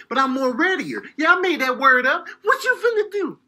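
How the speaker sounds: ordinary talk, nothing marked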